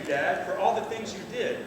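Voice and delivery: ordinary talk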